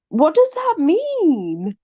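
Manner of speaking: high, slow, baffled question. Real, not acted. Vlogger baffled by a Yorkshire saying, high and drawn out. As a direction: stretch it out in total confusion.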